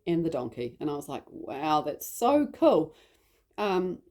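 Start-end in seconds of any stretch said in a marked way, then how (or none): 1.3-3.0 impressed. Retold or imitated. Mum reacting to daughter's angel list, impressed. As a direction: re-enact being awed by a child's story.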